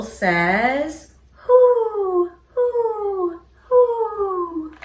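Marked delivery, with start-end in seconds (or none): 1.3-4.7 high, loud hooting owl. Acted. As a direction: hoot it out like an owl. Owl hoots from hole.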